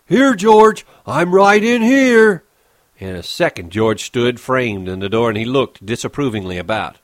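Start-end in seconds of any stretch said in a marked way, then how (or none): 0.1-2.4 higher, louder, slow call back. Acted. Lenny calls back to George, loudly. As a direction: shout back so he's found. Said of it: "he answered".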